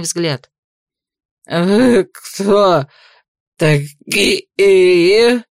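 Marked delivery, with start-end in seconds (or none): 1.5-5.4 put-on slurred, louder drunken question; tongue tripping, drunk; drunk slurring. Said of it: "заплетающимся языком спросил".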